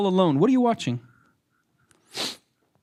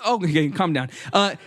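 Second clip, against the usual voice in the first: normal talking voice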